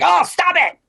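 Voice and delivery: high-pitched exasperated outburst; acted fed-up cry; angry lashing out. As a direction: snap at a swarm of pests, voice shooting up. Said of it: "go crazy and get angry".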